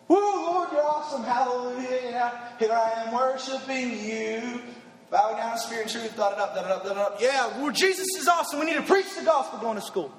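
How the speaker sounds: hammed-up gushing churchgoer; half-hearted imitation of gushy praise; mock worshipper. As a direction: mimic a shallow worshipper's praise, pitch jumping up at the start. Mocking shallow worship talk.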